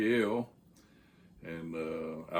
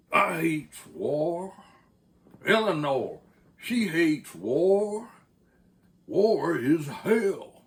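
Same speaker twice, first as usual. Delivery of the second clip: slow, much higher, louder hammed-up Southern Texas oration; K L's thick Southern Texas; 9 semitones higher, 7 dB louder, much slower than usual. K L orates to the grunt in thick Southern Texas. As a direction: lay on a thick Southern Texas accent like a grand speech. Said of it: "lay it on thick, that Southern Texas accent".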